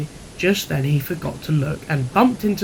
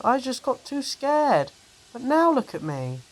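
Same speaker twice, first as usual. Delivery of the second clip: scared little engine; 10 semitones higher, 4 dB quieter than usual. Acted. Percy admits fear.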